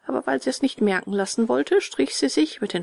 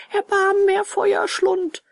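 tearful pleading; 12 semitones higher, 4 dB louder than usual. A narrator's reading. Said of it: "weinte und flehte". (hohes, flehendes Jammern)

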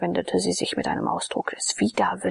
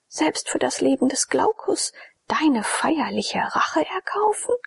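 put-on higher, indignant young woman; a proud girl hurling accusation, higher; Jone indignant; 6 semitones higher, 3 dB louder than usual. A narrator's reading. Jone refuses Abakes, indignant and higher.

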